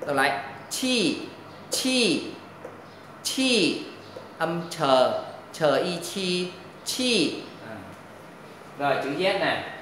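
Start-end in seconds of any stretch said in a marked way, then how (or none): 0.7-3.8 "chi" with a burst of air, high pitch dropping at the end; aspirated sound. Shown as a demonstration. Teacher contrasts the letter q for students, aspirated sound. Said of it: "Còn chữ quy đọc là". 4.7-7.4 "chờ" with a strong burst of air, then "chi"; aspirated sound. Shown as a demonstration. Teacher spells out the hard sound for students, aspirated sound. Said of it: "Phải có hơi bật ra".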